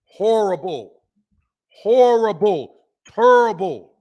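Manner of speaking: loud, drawn-out verdict in a put-on voice; Charles Barkley. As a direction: boom each word out as an impression. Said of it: "In my Charles Barkley voice".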